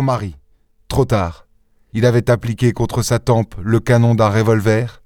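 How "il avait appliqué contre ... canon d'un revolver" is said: plain reading voice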